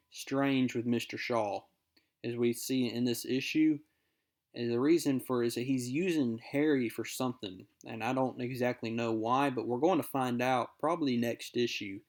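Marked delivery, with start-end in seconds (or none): none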